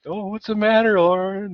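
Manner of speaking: hammed-up gloating dad voice; mock gloating dad. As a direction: rub it in playfully like a teasing father. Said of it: "he's like".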